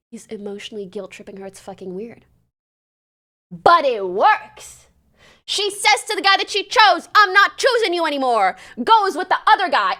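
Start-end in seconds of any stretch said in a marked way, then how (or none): 3.5-4.9 slow, loud punch on each word; loud exclamation. Real, not acted. Exclaiming the shocking outcome. 5.4-10.0 loud, high-pitched exclaiming; incredulous outburst. Real, not acted. Recounting a shocking turn.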